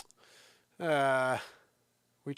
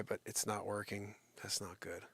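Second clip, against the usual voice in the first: whispered aside; 5 semitones lower, 12 dB quieter than usual. Real, not acted. Audio failing, said to self.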